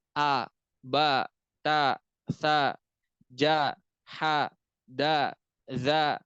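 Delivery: "A", "ba", "ta", "sa" with a clear open a, no o; light istifal letters. Shown as a demonstration. Teacher contrasting light letters for students. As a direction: keep every a thin and open. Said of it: "Contohnya apa?".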